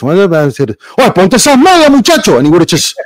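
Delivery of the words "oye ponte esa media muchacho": high, raised-voice impression of his father ordering in Spanish; mock fatherly command, pitched way up; stern Cuban dad. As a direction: imitate an old-school dad scolding his boy. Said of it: "So my dad would have said like this".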